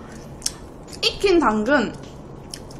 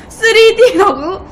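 laughing surprise; 7 semitones higher, 6 dB louder, slower than usual. Real, not acted. Surprised at viewer's 3D comment.

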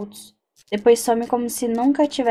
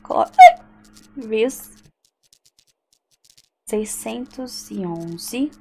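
spelling it out slowly; much slower than usual. Shown as a demonstration. Player dictating the room number to herself, very slow. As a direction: drag out "seiscentos e onze" word by word as she writes it. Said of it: "por isso que eu vou escrever".

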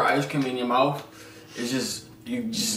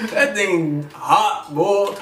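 slow laughing words; 6 semitones higher, much slower than usual. Real, not acted. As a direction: laugh through the burn. Mouth burning, laughing.

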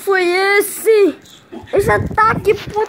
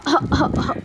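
ordinary talk, nothing marked

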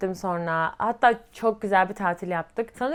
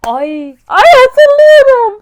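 very loud, high-pitched squeal of remembering; piercing cry of sudden recollection; sudden recall outburst; 14 semitones higher, 18 dB louder, much slower than usual. Real, not acted. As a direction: burst out as the memory hits, drawn out. Memory suddenly comes back.

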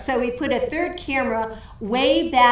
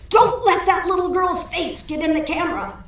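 booming director's call; 7 semitones higher than usual. Retold or imitated. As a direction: call it out like a voice from above. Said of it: "heard this big voice cry out".

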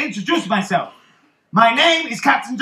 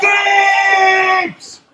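long, high-pitched shout of a name; calling out; 6 semitones higher, 4 dB louder than usual. Acted. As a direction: holler for a missing crewman, drawing it out. Calling out for missing crewman.

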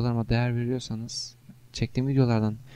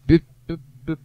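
beeping noise; 4 semitones higher, 10 dB louder, faster than usual. Retold or imitated. Gamer mimics the game's beeping sounds. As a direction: mouth beeps, loud and sharp. Imitating game beeps.

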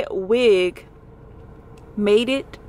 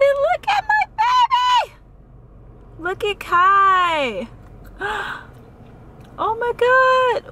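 very high, stretched squeals between gasps; excited squealing; 10 semitones higher, slower than usual. Real, not acted. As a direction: squeal with overwhelmed delight. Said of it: "So excited".

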